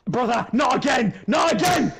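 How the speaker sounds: high, loud yell of frustration; exasperated shout. Real, not acted. Player told to calm down, killed again, exasperated shout. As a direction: yell in protest, rising to the second cry.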